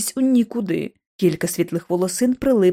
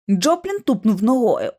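plain reading voice

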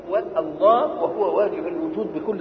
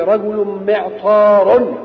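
رجل معطار drawn out very slowly and louder; loud slow teaching example; 9 dB louder, much slower than usual. Shown as a demonstration. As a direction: stretch out رجل معطار for the class to hear. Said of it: "تقول إيه؟". (نطق رجل معطار ببطء وبصوت أعلى)

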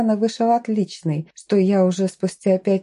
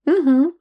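closed-mouth nervous hum, higher and louder; mumbled uh-huh; 7 semitones higher, 4 dB louder than usual. A narrator's reading. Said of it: "промычала".

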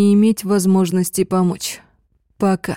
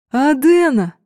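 joyful call; 6 semitones higher than usual. Acted. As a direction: call out with delight. Friend calls out joyfully. (радостный возглас)

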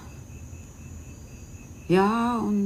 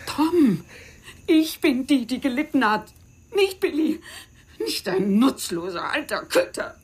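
anguished outburst; 6 semitones higher than usual. Acted. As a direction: cry out that she is the victim. Self-pitying outburst to Tom. (hoher, lauter, klagender Aufschrei)

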